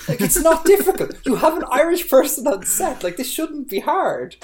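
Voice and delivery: high-pitched, comically exasperated outburst; exasperated rant. Real, not acted. Podcaster ranting about casting an Irish person, exasperated. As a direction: plead the obvious, voice pushed way up.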